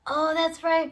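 normal talking voice

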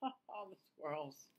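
ordinary delivery, nothing marked

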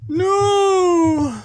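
high frantic crying no's; panicked crying. Real, not acted. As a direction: wail each no in real panic. Monster appears in horror game.

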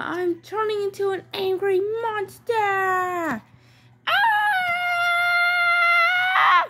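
crying-shout into a monster roar; grumpy doll turning into a monster; angry monster roar. Acted. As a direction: roar as you turn into a monster.

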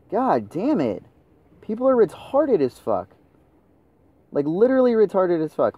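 high, whiny exasperation falling away at each phrase end; fed-up, high-pitched griping; exasperated. Real, not acted. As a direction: vent in real frustration at clueless teammates. Venting at teammates.

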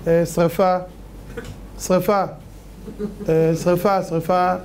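mention a fire as if it were nothing; listless, halting, everyday-pitched report; unbothered mock alarm. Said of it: "אז הוא עושה ככה". (אדיש, בלי שום בהלה)

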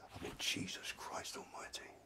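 hushed, voiceless oath of shock; stunned whisper. Retold or imitated. Teller hears of the shooting on the radio, appalled whisper. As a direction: whisper it as if the news just sank in.

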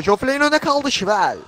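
sudden high, loud jump; excited play-by-play. Real, not acted. Commentator calling Niko's sudden chance, excited. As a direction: react urgently to the sudden chance.

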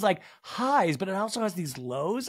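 usual speaking voice

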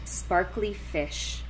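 ordinary delivery

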